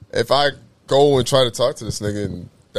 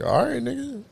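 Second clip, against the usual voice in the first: dad voice; 12 semitones higher, 4 dB quieter than usual. Retold or imitated. Dad's curt reply, voiced.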